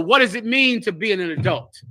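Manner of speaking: ordinary talk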